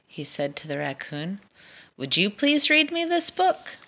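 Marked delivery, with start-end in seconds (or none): 2.0-3.8 high, pleading request; hopeful asking. Acted. Dragon pleading with the raccoon, high and hopeful. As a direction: lift it up, hoping for a yes.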